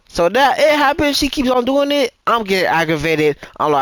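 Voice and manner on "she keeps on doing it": usual speaking voice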